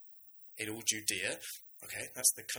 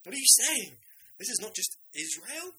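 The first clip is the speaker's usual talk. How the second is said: high, loud cry of disbelief; astonished disciples; 9 semitones higher, 6 dB louder than usual. Retold or imitated. Disciples react to Jesus, high and loud in disbelief. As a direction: blurt it out as if your mind was just blown. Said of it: "The disciples' minds must have been blown".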